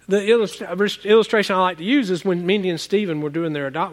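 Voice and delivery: normal talking voice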